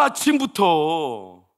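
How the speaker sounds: 아침부터 stretched out very slowly, loud but at normal pitch; drawn-out scolding. Retold or imitated. Father scolding daughter for crying, slow drawn-out 아침부터. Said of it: "나도 막 짜증이 나가지고".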